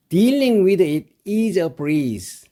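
very slow, each word laid out for learners; slow model reading. Shown as a demonstration. Teacher to students, very slow model reading. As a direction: read it out slowly for students to copy. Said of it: "이렇게 하면 되는데요".

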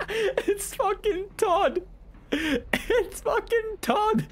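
very high, very slow, laughing through it; squealing disbelief. Real, not acted.

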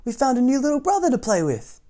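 put-on high, loud calling of young swans; excited calling. A narrator's reading. Young swans call their mother, high and excited. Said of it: "his new friends cried".

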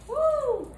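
high, joyful whoop; excited whoop. Real, not acted. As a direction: shout it out with delight. Chicken drops into oil.

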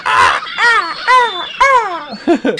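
high screeching seagull cries; screechy seagull voice. Pretend. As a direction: squawk like a seagull on the beach. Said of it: "My seagull voice".

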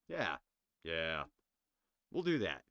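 sudden loud yeah; loud resolve. Real, not acted. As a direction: blurt it out loud.

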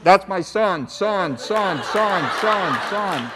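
words repeat and fade like an echo; echo reverb effect. Shown as a demonstration. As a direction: let the final word ring and die away like reverb. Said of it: "God puts reverb on something".

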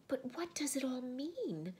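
put-on high girl's voice, without shouting; calmer questioning. A narrator's reading. Susan asks Aslan once calmer, high puzzled question. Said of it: "when they were somewhat calmer".

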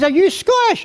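mock woman's high, astonished question; put-on high voice, rising sharply; high-pitched question. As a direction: imitate her in a high-pitched voice. Acted quote of the woman answering.

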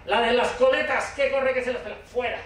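high, urgent calling out; single out the runner and order them out; playground bouncer. Acted. Speaker sends fast children off the playground, high urgent calling. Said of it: "Te pones ahí en una esquina y dices".

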